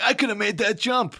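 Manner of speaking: put-on high, whiny teen boast; bratty teen. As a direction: whine it like a cocky kid. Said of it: "he was like".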